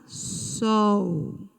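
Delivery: "sol" drawn out long; stressed syllable. Shown as a demonstration. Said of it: "A sílaba tônica na palavra girassol". ("sol" bem prolongado, como sílaba tônica)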